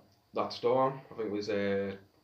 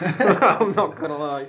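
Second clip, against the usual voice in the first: laughing; 6 semitones higher, 9 dB louder, much slower than usual. Real, not acted. Laughing confession.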